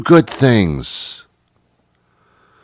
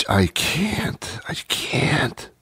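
breathless whispered surrender; real whisper; 5 semitones higher, 9 dB quieter, much slower than usual. Real, not acted. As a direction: fade into a whisper, overwhelmed. Overwhelmed, gives up speaking.

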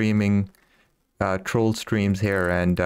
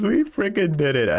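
excited; 8 semitones higher than usual. Real, not acted. Celebrating trophy catch.

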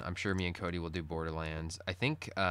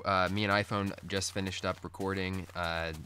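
usual speaking voice

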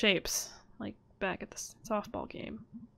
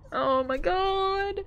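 slow, drawn-out, much higher and louder oh my God; stunned gasp; 11 semitones higher, 6 dB louder, much slower than usual. Real, not acted. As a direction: let the shock stretch every word. Shocked outburst.

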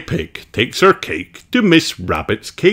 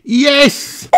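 very high, eager little yes; Peppa's voice; 4 dB louder than usual. Acted. Peppa welcoming her grandparents, very high and eager. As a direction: squeak it out brightly. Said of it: "says Peppa".

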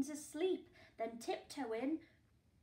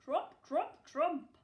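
loud, higher trumpet call; elephant trumpeting; 4 semitones higher, 10 dB louder, slower than usual. Acted. Narrator voicing the elephant's loud trumpeting call. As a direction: blare it like an elephant. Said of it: "The elephant trumpeted".

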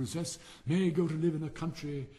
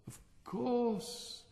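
self-important; 10 semitones higher than usual. A narrator's reading. Rocket seizes on flattering answer.

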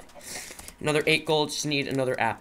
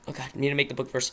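normal talking voice

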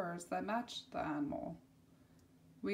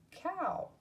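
cow lifted much higher; high repeat; 9 semitones higher than usual. Shown as a demonstration. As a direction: toss cow up high and playful. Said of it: "second take of word".

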